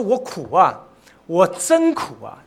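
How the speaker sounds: loud, drawn-out cry of anguish; impassioned preacher's outcry. Retold or imitated. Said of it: "他呐喊了".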